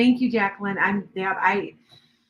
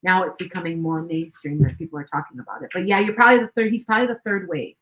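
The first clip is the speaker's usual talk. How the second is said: ordinary talk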